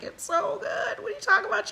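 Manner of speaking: high, breathy mock protest; high pitched mock disbelief; quoted outrage. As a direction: plead the case for cheese, lightly hammed. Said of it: "And I'm like".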